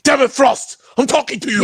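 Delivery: high, loud vampire-leader impression; Udo Kier. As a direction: call out indignantly as an actor's character. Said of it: "Udo Kier".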